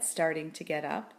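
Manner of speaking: usual speaking voice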